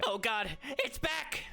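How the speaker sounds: frightened goblin hiding behind a tree, wailing in panic; panicked goblin. Acted. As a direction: squeal it out in terror as the beast returns. Goblin panics at returning creature.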